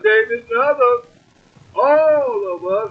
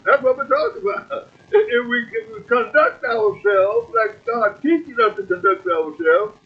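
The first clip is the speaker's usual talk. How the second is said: usual speaking voice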